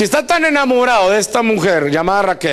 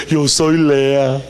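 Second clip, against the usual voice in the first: slow, lower, lightly put-on; coy bride voice; 4 semitones lower, much slower than usual. Lea answering Jacob in a slow, lightly put-on voice. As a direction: answer sheepishly.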